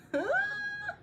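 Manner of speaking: squeaky giggle; high-pitched squealing laugh. Real, not acted. Squeals at own confusion.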